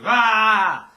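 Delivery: sharp high shouted ah; high yell. Shown as a demonstration. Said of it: "you basically take a yell".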